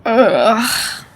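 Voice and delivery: groggy half-asleep mumble; just waking from a bad dream; mumbling in sleep. Real, not acted. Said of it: "You were mumbling in your sleep".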